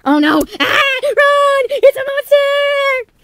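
very high, loud shriek, last word drawn out; hammed-up monster-movie panic; terrified screaming. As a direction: scream a warning as you flee. Fleeing from a monster.

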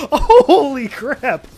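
loud, drawn-out startled cry; startled yell. Real, not acted. Player startled by an enemy, loud slow yell. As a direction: yell it, really caught off guard.

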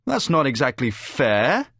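slow, plaintive objection; complaining. A narrator's reading. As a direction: whine it slowly with a rising end. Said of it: "he complains".

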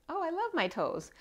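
playful imitated gush; delighted quote. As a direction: squeal it with sudden delight. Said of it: "now they're like".

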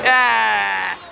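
a sudden loud "Yes" for the keeper; cheering shout. Real, not acted. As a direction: shout it out as the save goes in. Cheering a save.

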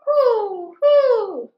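high loud hoots; owl hoot. Acted. Reading to kids, the owl hooting loud.

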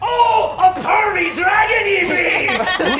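high, loud, slow pirate bellow; pirate captain. Acted. Pirate captain mocking the dragon figurehead, loud and gleeful. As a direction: roar it out with relish.

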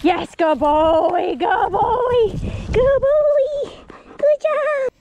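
very high, loud, slow dog praise; squeaky praising a dog. Real, not acted. Praising dog after find.

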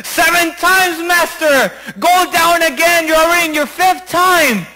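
high, insistent coaxing; urging servant. Acted. Servant urging his master back under, high and insistent. Servant urges the fifth dip.

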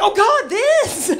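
slow, high, loud cry of dismay; hammed-up horrified exclamation; acted horror. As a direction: wake up and wail at the damage. Waking to injury, acted horror.

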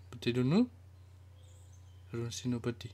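ordinary talk, nothing marked

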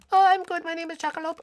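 tiny squeaky falsetto; Jackalope. Acted. Gamer answering for his friend Jackalope, squeaky put-on voice. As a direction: put on a very high silly voice. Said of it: "That's just not how Jackalope talks".

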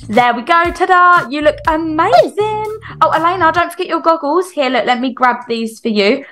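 ordinary talk

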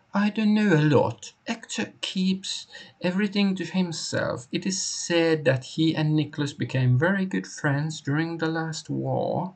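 high-pitched character reading; put-on high voice for a game informant. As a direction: voice the game's dialogue high, in character.

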